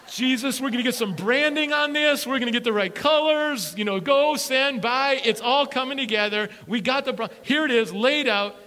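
high, loud hyped pitch; excited disciples. Pretend. Disciples hyping their plan to Jesus, excited. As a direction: sell it like a startup pitch.

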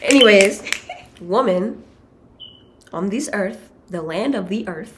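long pauses between "Anyways", "woman" and "on this earth"; slow spoken-word phrasing with pauses; dramatic pauses. Shown as a demonstration. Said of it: "Yeah, say it like that".

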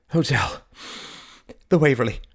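dizzy gasp to the driver. Acted. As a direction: blurt it out, faint and short of breath. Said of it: "gasped Mary, her vision swimming".

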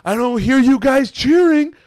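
loud, high-pitched mock indignation; retold heckling demand. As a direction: imitate a fan loudly demanding an answer. Retold heckler demanding cheers from fans.

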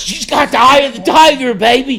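loud, very high-pitched excitement; screaming fan hype. Retold or imitated. Friend cheering Rousey's walkout, screaming excitedly. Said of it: "they were screaming".

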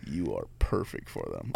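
low, sly verdict; knowing shop owner. Retold or imitated. Shop owner telling the young driver he suits older women, low and sly.

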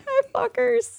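very high, small, softened voice; cheeky squeaky hello; mock sweet greeting. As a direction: greet them in a tiny teasing falsetto. Pretend greeting to old colleagues.

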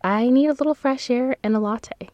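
Salem. Retold or imitated. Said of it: "I feel like I need to quote Salem".

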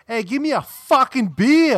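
put-on old man barking for a beer; loud Yankee demand. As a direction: yell it like a crusty old Yankee. Imitating Dick yelling at waitress.

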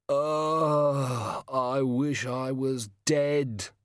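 slow, drawn-out miserable moan. A narrator's reading. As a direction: moan it like you'd rather be dead. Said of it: "it moaned".

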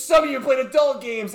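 loud, high jab; teasing accusation. Retold or imitated. Kid accusing the audience, loud and high. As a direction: call out the crowd. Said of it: "Who else has played".

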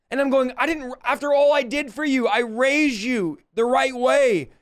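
high, strained plea of an imagined rejection; anguished father. Acted. Father imagining his daughter leaving home, anguished high plea.

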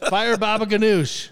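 loud, high chef call; kitchen call-out. Pretend. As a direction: bark the order across the kitchen. Calling out a kitchen order.

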